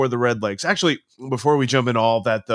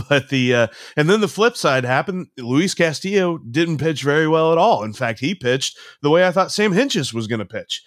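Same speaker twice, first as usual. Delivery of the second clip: ordinary talk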